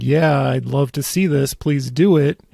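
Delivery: ordinary talk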